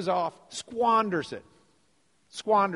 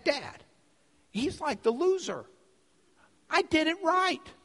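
high, mock-whining older brother; acted indignant sibling, pitch leaping up; whiny complaint; 12 semitones higher than usual. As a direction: protest to Dad like a wronged kid.

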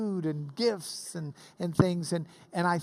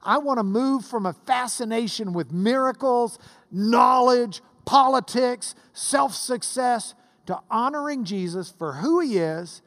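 raised, emphatic list from the pulpit; impassioned preaching; 5 semitones higher, 5 dB louder than usual. Real, not acted. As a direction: punch out each item to the congregation.

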